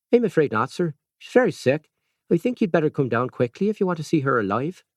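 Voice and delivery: put-on careful Irish accent pressing him to hurry. A narrator's reading. Nurse urging the doctor to come quickly, careful Irish accent. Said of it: "in her careful Irish accent".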